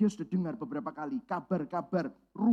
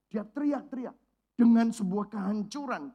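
ordinary delivery

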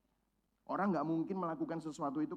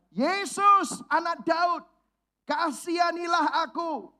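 much higher and louder, a desperate plea; beggar's cry; 9 semitones higher, 6 dB louder, much slower than usual. Acted. Blind beggar calling out to Jesus over the crowd, desperate loud cry. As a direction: cry out to Jesus as if your life depends on it. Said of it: "teriakan si pengemis buta ini".

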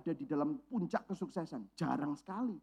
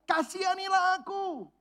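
desperate cry; 11 semitones higher, slower than usual. Acted. Beggar crying out to Jesus for mercy, high and desperate. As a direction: beg for mercy at full voice. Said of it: "Dia teriak, teriak, teriak".

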